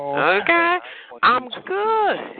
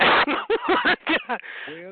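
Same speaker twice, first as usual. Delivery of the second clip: high laugh; 11 semitones higher than usual. Real, not acted. Laughs after insult.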